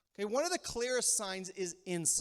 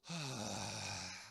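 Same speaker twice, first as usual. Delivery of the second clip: long, low exasperated sigh; contemptuous sigh; 10 semitones lower, 10 dB quieter than usual. Shown as a demonstration. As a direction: heave an eye-rolling sigh. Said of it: "That's contempt".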